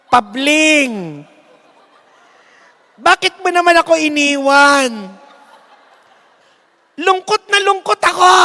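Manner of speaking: mock grief that sounds like laughing; laugh-cry wail. Widow mourning her husband, laughing mock wail. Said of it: "Hindi namin malaman kung umiiyak siya o tumatawa".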